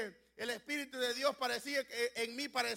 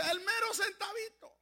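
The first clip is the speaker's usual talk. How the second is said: triumphant exclaim; 8 semitones higher than usual. Retold or imitated. Someone exclaiming a perfect fit.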